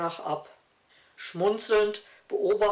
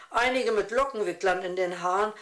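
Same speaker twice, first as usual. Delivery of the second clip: ordinary delivery, nothing marked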